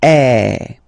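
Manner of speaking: loud open eh, jaw dropped; open French è. Shown as a demonstration. Teacher comparing è with é for students. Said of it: "the second one is".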